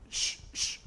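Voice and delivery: whispered hushing; shushing mom. Retold or imitated. Mom hushing child while everyone looks, whispered. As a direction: hush under your breath.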